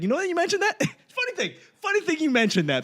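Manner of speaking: high-pitched, laughing relief in a retold reply; laughing agreement. Man jumping at the girlfriend's doubt about him, laughing eagerly. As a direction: re-enact it through giggles, pitched high. Awkwardly seizing on her doubt.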